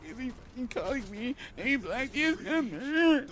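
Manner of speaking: high, swooping put-on female voice; hammed-up high-pitched woman; exasperated woman impression. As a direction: whine it high as the baffled victim. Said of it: "that's how she sounds".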